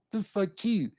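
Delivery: mock Baltimore accent, fronted "you". Streamer imitating characters from The Wire, mocking Baltimore accent. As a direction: exaggerate the Baltimore "oo" vowel.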